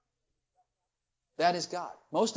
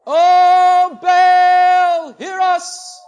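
loud, high wailing shout to Baal; priests crying out; 10 semitones higher, 17 dB louder, much slower than usual. Retold or imitated. Preacher mimics Baal priests calling, loud wailing shout. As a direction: bellow it up to a deaf idol. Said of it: "Gotta shout loud for Baal to hear you".

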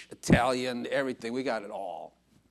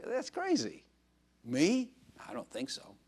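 disbelief; 7 semitones higher than usual. Real, not acted. Disbelief at being loved.

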